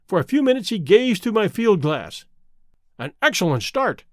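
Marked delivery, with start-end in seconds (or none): none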